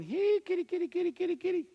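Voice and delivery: high-pitched "here, kitty" call; cat-calling voice. Retold or imitated. A voice luring him like a cat, high calling. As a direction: coax a cat in a high, repetitive little voice. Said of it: "almost like a voice going".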